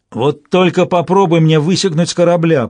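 loud brutish threat; orc. Acted. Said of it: "предупредил Торк".

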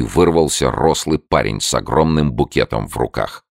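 plain reading voice

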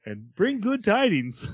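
high, slow sing-song proclamation; hammed-up demon voice, pitch swooping; Red-Faced Demon. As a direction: stretch it out like a demon bearing news. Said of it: "he has this voice".

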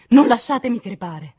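loud, high desperate plea; panicked shout; sudden cry. A narrator's reading. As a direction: cry out begging for life. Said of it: "Gridò improvvisamente".

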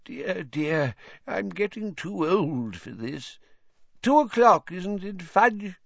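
lightly voiced feeble old man; quavering, slow old wizard. A narrator's reading. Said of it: "said in a feeble voice".

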